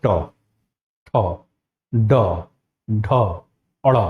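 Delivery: each retroflex consonant said slowly and separately; ट ठ ड ढ अड़, each with the tongue curled back and a pause; articulated retroflex consonants. Shown as a demonstration. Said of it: "जैसे बोलते हैं". (हर व्यंजन मूर्धा से अलग-अलग, धीरे बोलें)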